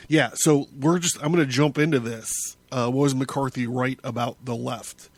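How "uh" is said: normal talking voice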